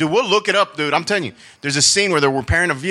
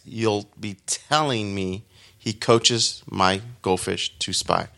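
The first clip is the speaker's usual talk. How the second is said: slow careful read; 5 semitones lower, 3 dB quieter, slower than usual. Shown as a demonstration. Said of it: "That is a tricky line".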